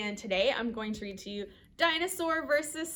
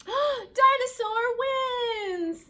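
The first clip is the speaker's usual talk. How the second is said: loud, high, stretched victory cry; gasp, then cheer the winner; big slow cheer; triumphant announcement; 11 semitones higher, 6 dB louder, slower than usual. A narrator's reading.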